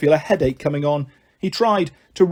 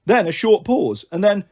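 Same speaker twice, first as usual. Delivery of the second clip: ordinary delivery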